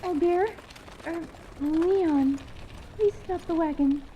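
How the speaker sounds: high, urgent plea from inside the wagon; panicked. A narrator's reading. Anise calling to Leon, panicked and high. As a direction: voice rising in sudden panic. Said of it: "A voice follows, colored by panic".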